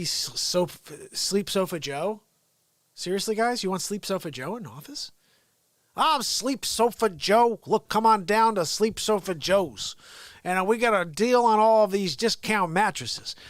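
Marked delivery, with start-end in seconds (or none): 5.9-13.4 slow hammed-up furniture salesman; mock ad pitch. Mocking Biden as a slow furniture store pitchman. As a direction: sell sofas like a cheesy TV ad.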